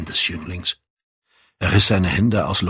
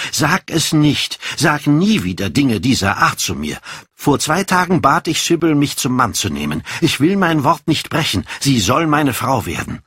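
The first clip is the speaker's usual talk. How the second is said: put-on higher, heated young Dorian; insistent Dorian; 7 semitones higher than usual. Dorian defends his marriage to Harry, heated and insistent. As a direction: insist firmly, pitch raised.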